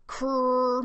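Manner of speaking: "Krr" held long on a steady note; sounding out. Shown as a demonstration. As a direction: stretch out "Krr" while decoding it. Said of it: "and they're saying krr".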